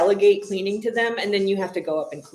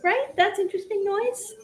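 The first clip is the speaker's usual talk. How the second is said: pet talk; 13 semitones higher than usual. Acted. Cooing at the pet.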